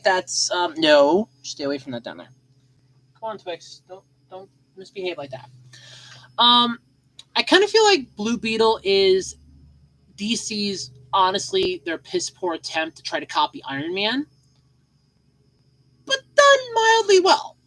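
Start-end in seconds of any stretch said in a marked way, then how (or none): 3.2-5.5 soft scolding. Real, not acted. Scolding cat softly. 16.0-17.6 mock grudging praise. Real, not acted. Mock praise, high wavering.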